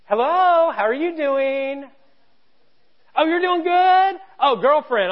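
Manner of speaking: sweet phone voice. Retold or imitated. Angry person suddenly sweet to a friend on the phone. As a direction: switch to syrupy sweetness for the caller. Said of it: "you pick up the phone and you're like".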